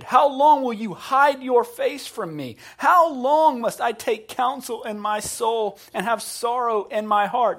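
loud, high cry to God; David. Retold or imitated. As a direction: cry it out as a pleading lament. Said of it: "That's a cry that we're familiar with".

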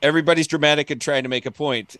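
ordinary delivery

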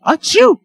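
loud sudden sneeze; a big real achoo. Real, not acted. Mock sneeze after splashing.

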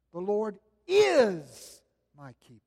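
"is" stressed in "The Lord is my keeper"; emphasis on each word. Shown as a demonstration. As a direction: lean hard on the word "is". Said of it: "placed emphasis on each individual word".

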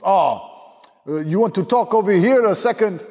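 high-pitched mock French accent; French party host. French host pulling a guest aside, mock French accent. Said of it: "this fat guy comes over to me".

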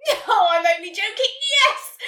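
very loud, high burst of joy; joyful blurt. A narrator's reading. Nelly accepting Santa's offer, joyfully. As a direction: blurt it out, soaring on the last word. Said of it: "she blurted out with joy".

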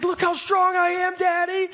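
a hint of a kid bragging to his dad; proud little boy. Five-year-old son voiced.

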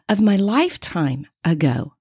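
ordinary delivery

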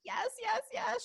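high, rapid, eager yeses; eager agreement. Retold or imitated. Imitating Jean eagerly agreeing with the medium, high and thrilled. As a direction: nod along, thrilled.

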